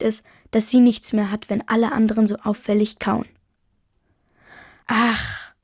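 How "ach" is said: long, airy "Ach" sigh; contented sigh. A narrator's reading. Mother after the best meal in ages, sighing. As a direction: let out a long sighing breath. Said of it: "seufzt Mama".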